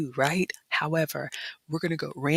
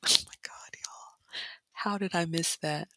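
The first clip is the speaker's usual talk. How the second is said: softer whispered regret; dismayed whisper; 7 dB quieter than usual. Real, not acted. Vlogger regretting the croissant she missed, dismayed whisper. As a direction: whisper it like you can't believe it.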